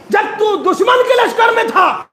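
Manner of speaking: slow rising wail; impassioned cry. Acted. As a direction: lift the line into a grieving cry. Imam speaks with emotion.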